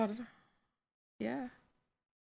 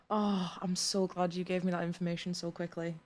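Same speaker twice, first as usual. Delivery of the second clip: normal talking voice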